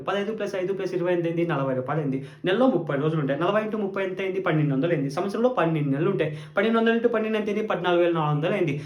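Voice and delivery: fast, rattling off sums; tally it up quickly like a shopkeeper; rapid calculation. Acted. Rapid mental arithmetic. (వేగంగా లెక్కలు చెప్పినట్టు)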